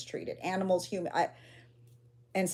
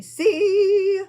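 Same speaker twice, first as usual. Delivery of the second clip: slow, high, louder sing-song; drawn out; 12 semitones higher, 9 dB louder than usual. Real, not acted. Streamer scanning live chat, drawn-out sing-song. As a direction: stretch it out as you look.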